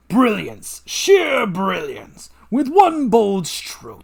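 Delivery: gloating evil genius, slow and grand; villain voice. Acted. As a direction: savour every word like a mad scientist.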